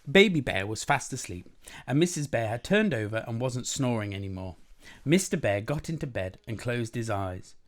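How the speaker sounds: usual speaking voice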